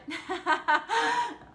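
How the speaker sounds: high, loud burst of giggles; bubbling high-pitched laugh. Real, not acted. Laughs at own dodgy accent.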